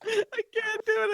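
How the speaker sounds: high, cracking up mid-line; giving up the voice through laughter; breaking up laughing. Real, not acted. Host breaking character, laughing at his own impression. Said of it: "I can't take it seriously".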